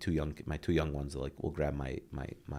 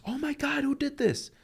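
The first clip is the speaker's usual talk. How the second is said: very high, loud mock freak-out; hammed-up horrified outcry; imitated outraged kid; 4 dB louder than usual. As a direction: shriek it like a kid finding a mess. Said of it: "he makes a big mess".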